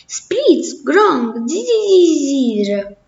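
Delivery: high, jumpy cartoon noises; comic-book gibberish. A narrator's reading. Comics mouse answering the others in comic noises. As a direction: squeak out sound effects. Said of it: "rispondeva il topo dei fumetti".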